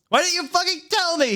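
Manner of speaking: very high, shrill shouted accusation; shouting. Acted. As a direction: scream it at her. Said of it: "he shouted".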